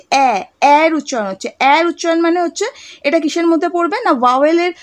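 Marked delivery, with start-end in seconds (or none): 0.1-0.4 "অ্যা" said as an open vowel; vowel sound demo. Shown as a demonstration. Teacher shows students why M A takes an, demonstrating the vowel sound. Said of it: "উচ্চারণটা কি হচ্ছে".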